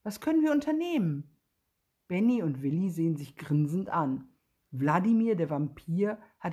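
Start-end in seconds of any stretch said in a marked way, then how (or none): 0.0-1.3 high, fast, eager question; bubbling over with plans; very excited. A narrator's reading. Wladimir asking the tired boys what to do, very excited. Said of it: "fragt Wladimir ganz aufgeregt".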